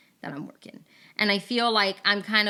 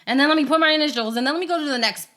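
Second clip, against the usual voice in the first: hurried routine; 5 semitones higher, 7 dB louder, much faster than usual. Retold or imitated. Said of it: "I'm just like".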